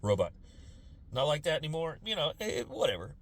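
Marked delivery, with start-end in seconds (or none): 0.0-2.0 lower, softer sincere apology; mild apology. Retold or imitated. Apologizing to the officer, low and soft. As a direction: quietly own up to the officer. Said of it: "be like".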